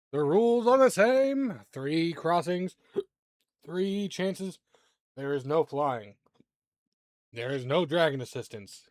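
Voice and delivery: loud, high ringmaster proclamation; beam master announcing. Acted. Beam master reinstates the rules, booming announcer delivery.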